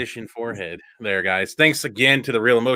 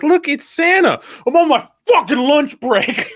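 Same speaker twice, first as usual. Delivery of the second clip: loud, much higher movie-quote outburst; mock irate yell; 14 semitones higher, 5 dB louder, slower than usual. Santa to a mother on his break, mock irate yell. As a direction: imitate Santa blowing up, dragging out the curse. Acted Bad Santa yelling at mom.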